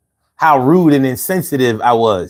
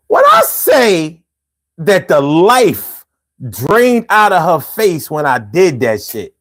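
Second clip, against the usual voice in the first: slow, drawn-out build; dramatic slowdown; 3 semitones higher, slower than usual. Acted. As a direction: stretch every word for drama. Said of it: "dramatic emphasis".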